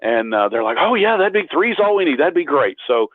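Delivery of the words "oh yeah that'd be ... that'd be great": mildly excited, higher-pitched imitation; retold eager reply. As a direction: voice their eager yes, pitched up. Retold eager reply to his offer.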